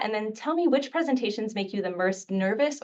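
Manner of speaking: ordinary talk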